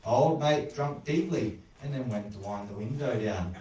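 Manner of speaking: ordinary delivery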